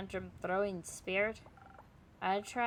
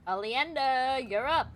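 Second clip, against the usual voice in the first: high, loud call to step up; hollered summons; 4 semitones higher, 8 dB louder, slower than usual. Acted. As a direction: call them over loudly. Calling a character forward.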